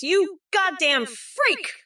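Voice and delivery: drawn-out, high-pitched insult; slow high yell. Acted. Insulting a chat user, slow high yell. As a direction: stretch it out in a high mock yell.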